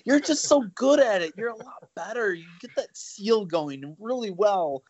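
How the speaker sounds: high-pitched imitation of a coaxing wife; mock flattering wife. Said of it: "My wife's a smart cookie. She's like".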